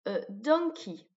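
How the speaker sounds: normal talking voice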